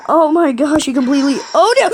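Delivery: usual speaking voice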